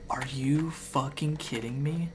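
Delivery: whispered, exasperated disbelief; disbelieving whisper. Retold or imitated. Gamer whispering in disbelief as the spooky music starts. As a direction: hiss it under your breath in disbelief. Door opens on its own.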